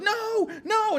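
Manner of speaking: high, drawn-out wail of mock grief; mock sobbing. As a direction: wail it high, hamming up the despair. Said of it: "is sobbing".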